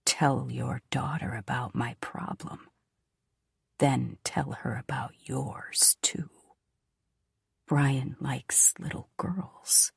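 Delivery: low, slow echoing whisper; eerie whisper. Acted. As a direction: let the words haunt, barely voiced. Memory of voices echoing, whispered.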